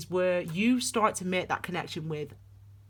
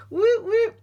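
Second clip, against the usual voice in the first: high, loud woo-woo; excited whooping; 14 semitones higher, 5 dB louder than usual. Real, not acted. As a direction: whoop with real glee. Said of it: "Let me just calm my wand energy down".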